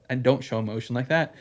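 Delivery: ordinary talk, nothing marked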